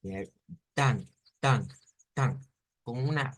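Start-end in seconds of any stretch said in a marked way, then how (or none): 0.7-2.4 "tan" ending in a nasal ng-like hum; nasalized. Shown as a demonstration. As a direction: nasalize each "tan" separately. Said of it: "con una nasalización".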